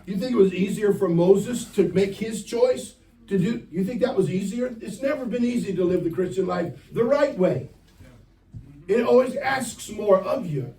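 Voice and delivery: impassioned preacher, high and loud; rising sermon delivery; preaching. Acted. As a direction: preach it to the young people with fire. Preaching to youth group.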